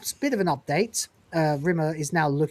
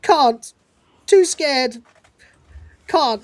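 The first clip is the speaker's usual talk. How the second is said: high, louder clipped refusals; mock terrified; 7 semitones higher, 5 dB louder, much slower than usual. Rimmer refusing to act out of fear, mock terrified high voice. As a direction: freeze up with fear, hammed up. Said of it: "He goes".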